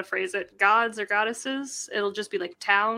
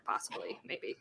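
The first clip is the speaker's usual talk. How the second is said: coy whisper; 17 dB quieter than usual. Real, not acted. Coy whisper about Asher dance.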